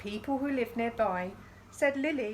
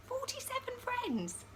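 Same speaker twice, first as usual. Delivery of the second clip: much higher, softer squeal of wonder; amazed; 7 dB quieter than usual. Pretend. Reading to children, amazed at the guest count. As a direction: marvel at the number.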